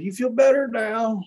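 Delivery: lightly imitating the hurting woman offering a hug; the woman asking. Voicing the woman in story.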